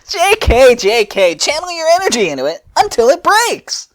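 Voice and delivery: high, loud eager shout; high manic Fu urging her on; high excited Fu voice. Acted. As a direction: push it up high like a manic inventor. Fu voiced in the story.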